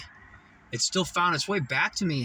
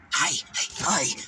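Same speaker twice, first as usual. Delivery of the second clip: hallucinated voice; 2 semitones higher, slower than usual. Retold or imitated. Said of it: "I was hearing".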